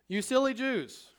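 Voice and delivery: high, scornful rebuke; mock-stern apostle; Peter scolding.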